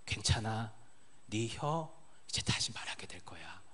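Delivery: slow, soft, reassuring whisper; gentle whisper. Pretend. Jesus reassuring the deaf man, soft whisper. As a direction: hush him like a frightened child.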